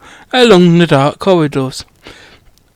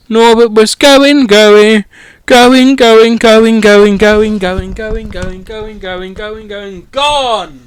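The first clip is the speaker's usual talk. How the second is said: fading away; slower than usual. Pretend. As the dragon flies off, the reader fades going, going, gone. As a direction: let the voice fade into the distance.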